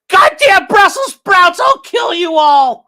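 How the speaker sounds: slow, high-pitched yell of over-the-top hatred; real hate. Acted. Mocking viewer's idea of hating foods, hammed-up hateful scream. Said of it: "hate is a very specific thing, like, real hate".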